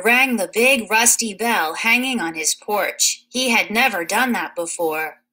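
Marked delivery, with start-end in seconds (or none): none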